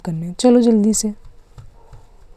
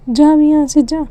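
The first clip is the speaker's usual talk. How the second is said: shooing away; 5 semitones higher, 6 dB louder than usual. Acted. Sister shoos brother away.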